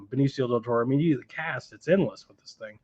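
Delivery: ordinary talk, nothing marked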